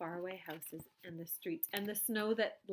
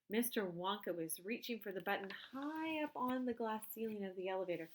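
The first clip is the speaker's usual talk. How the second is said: plain reading voice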